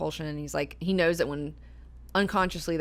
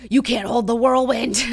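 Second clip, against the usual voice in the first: loud, high, defiant declaration; pulling free from a grabbing man, loud and defiant; defiant retort; 6 semitones higher, 10 dB louder than usual. Pretend. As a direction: fling it back as you pull away. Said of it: "I am a hundred percent gonna say".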